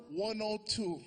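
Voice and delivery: normal talking voice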